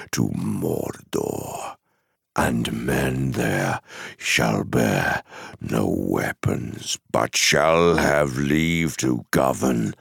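low, slow, menacing envoy; dark messenger pronouncing his master's demands; Mouth of Sauron. Acted. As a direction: dictate terms slowly in a low, imperious voice. Messenger of Mordor dictates terms.

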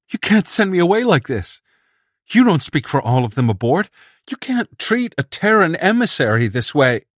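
high, loud indignant plea; indignant protest. Acted. Emissary protests being thrown off the ship, high indignant plea.

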